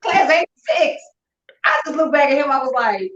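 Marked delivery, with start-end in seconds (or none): 0.0-1.0 higher mock scoff at his claim; incredulous retort, pitched up; scoffing disbelief. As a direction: call his bluff with a raised, incredulous voice. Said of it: "I said".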